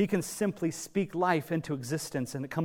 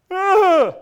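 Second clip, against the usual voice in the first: very high, loud hammed-up wailing groan; mock lament groan; 15 dB louder than usual. Preacher mimicking the wailers' lament, hammed-up groan. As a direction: overdo a mourner's groan.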